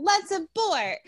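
high, bright mock call to abort; mock cheerful slogan. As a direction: sing it out like a silly slogan. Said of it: "Nobody is out there saying".

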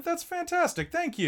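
high, delighted thanks; excited. Real, not acted. Streamer still squealing her thanks to Drew. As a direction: gush with real joy. Thanking gift sub giver.

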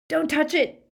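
high, loud pained yell. A narrator's reading. Harry yells at Greyback touching his scar, loud pained shout. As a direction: shout it out through the pain. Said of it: "Harry yelled".

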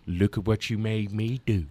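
quietly acted blame; hushed menacing quote. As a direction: say it low and controlled, as a mock threat.

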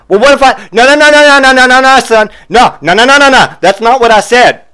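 high, loud, frantic no-no-no; mock shrill interrupting wife. Wife cutting off the clerk, high and loud.

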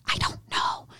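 whispered, unvoiced and soft; scared whisper. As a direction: breathe it out like a scared kid. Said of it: "He goes".

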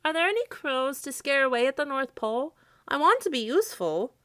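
high, mildly anxious scarecrow voice. A narrator's reading. Scarecrow wants to be useful, mildly anxious. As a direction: ask high and eager, a touch worried. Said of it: "Jimmy Scarecrow said anxiously".

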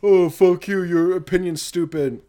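hammed-up internet insult; mock angry commenter. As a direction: fling it like a troll in the comments.

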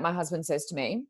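normal talking voice

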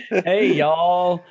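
Oklahoma drawl. Acted. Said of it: "even your Oklahoma accent is terrible".

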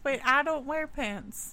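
ordinary talk, nothing marked

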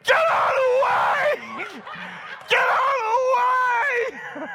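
retold panicked scream at a man on the slope. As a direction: yell a panicked warning down the slope. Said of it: "So I just start screaming".

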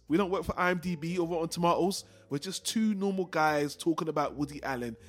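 ordinary delivery

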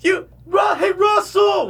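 high mock movie scream. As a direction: holler the name like a film character. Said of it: "where he screams Russell".

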